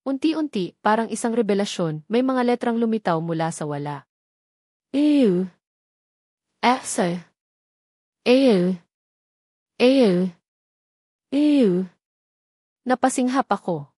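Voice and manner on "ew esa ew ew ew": each letter called out slow and loud; spelling out letters. A narrator's reading. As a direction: read every letter like a code being cracked. (binibigkas nang malakas ang bawat titik)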